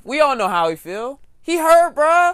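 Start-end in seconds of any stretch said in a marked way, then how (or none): 1.4-2.3 mock whiny. Mocking Chris being hurt.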